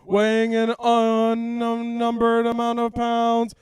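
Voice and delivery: booming ring announcer, drawn out; grand entrance announcement. Pretend. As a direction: hype him into the ring like a boxer. Boxing ring intro of cohost.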